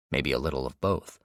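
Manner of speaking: plain reading voice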